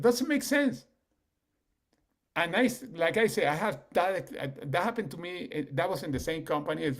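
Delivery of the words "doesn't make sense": exasperated. Real, not acted. Exasperated at reasoning.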